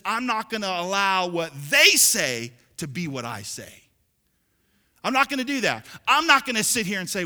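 punchy preacher quoting a defiant refusal; loud, driven declaration easing at the end; emphatic resolve. Retold or imitated. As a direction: declare it loudly as David's firm decision. Preacher voicing David's firm decision.

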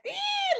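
short high squeal, as if a semi just whooshed past; mock frightened squeal. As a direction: let out a tiny startled squeak. Imitating reaction to passing semis.

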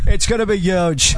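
slow, hammed-up celebrity impression; Donald Trump. Shown as a demonstration. Host proves his impression to doubting co-host, slow Trump voice. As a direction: drag it out like a boastful caller. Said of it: "Like this".

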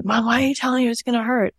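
put-on high, quick, indignant kid voice; protesting daughters. Older daughters protest to mom in a high, quick voice. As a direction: whine it fast like a protesting older sister. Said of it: "And they kept saying".

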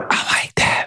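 soft whisper. Real, not acted. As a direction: breathe it out in a whisper. Relaxed after exhale.

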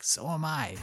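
faint, feeble and high; weak voice. Retold or imitated. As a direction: barely get the words out. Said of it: "in, like, a really weak voice".

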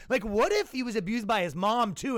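hammed-up TV profiler; mock eager detective. TV profilers theorizing.

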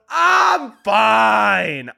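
loud high insisting; mock shout. Comic shouting to viewers that he is fine. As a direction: yell that you're fine.

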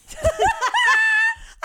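loud high cackle; shrieking laugh; burst of high laughter. Real, not acted. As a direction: crack up at the memory. Cracks up at the caller.

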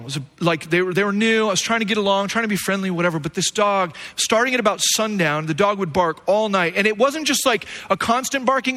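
ordinary delivery

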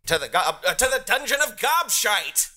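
high, booming villain welcome; goblin king cry. Acted. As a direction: proclaim it like a pompous goblin king. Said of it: "who begins to cry at you".

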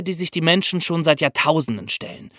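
usual speaking voice

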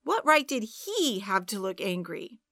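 ordinary delivery, nothing marked

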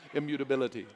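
immutability with every syllable crisp and a laugh; im-mu-ta-bil-i-ty, lips working hard; over enunciating. Shown as a demonstration. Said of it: "Makes my lips move in ways".

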